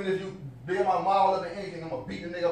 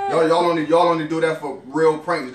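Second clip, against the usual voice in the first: loud, slow insisting; 9 dB louder, slower than usual. Real, not acted. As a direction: hammer each word out to the camera. Insisting loudly to viewers.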